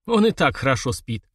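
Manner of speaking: ordinary delivery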